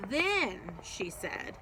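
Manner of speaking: plain reading voice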